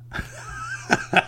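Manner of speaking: words spilling out through a real laugh. Real, not acted. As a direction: still chuckling at the punchline. Laughing at own joke's punchline.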